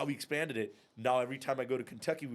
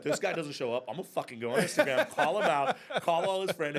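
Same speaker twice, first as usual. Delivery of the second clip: imitating her threat, louder and higher, laughing; half-laughing hammed-up fury; mock angry rant; 6 semitones higher, 7 dB louder than usual. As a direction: build to a higher, louder mock yell on call him out. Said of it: "She's like".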